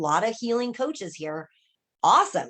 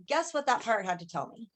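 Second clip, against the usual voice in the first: normal talking voice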